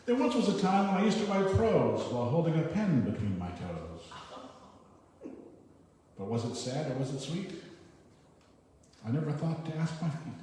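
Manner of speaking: lifted, rhythmic rhyming recital; light verse reading. Acted. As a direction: read out the rhyme, pausing before each punchline. Reads a light rhyming poem.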